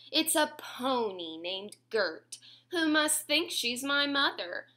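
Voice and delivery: put-on lilting read-aloud to kids; sing-song storybook reading; reading to children. A narrator's reading. Reading a bedtime rhyme to little listeners, sing-song.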